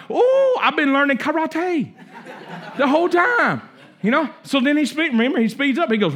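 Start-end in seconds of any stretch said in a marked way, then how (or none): 0.1-3.6 much higher, laughing discovery; light-bulb moment, delighted; student finally getting it, laughing; sudden realization. Retold or imitated. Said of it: "He's like".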